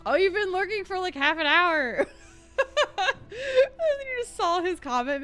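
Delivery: ordinary talk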